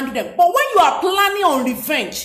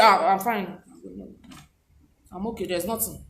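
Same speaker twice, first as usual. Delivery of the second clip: low, very soft dismissive mumble; acting out a withdrawn, bitter reply; bitter brush-off; 7 semitones lower, 7 dB quieter than usual. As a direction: shrink the voice into a sullen brush-off. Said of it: "That is bitterness".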